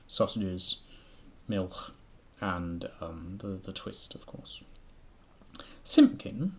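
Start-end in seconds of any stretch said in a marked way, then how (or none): 5.8-6.5 calling Simpkin. A narrator's reading. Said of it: "said the tailor".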